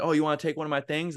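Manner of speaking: quick mock-indignant challenge; retold anger. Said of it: "I would get angry so much".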